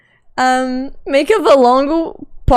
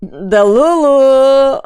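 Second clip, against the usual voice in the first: drawn-out dramatic announcement; 3 semitones higher, 7 dB louder, much slower than usual. Pretend. Dramatic naming of viewer.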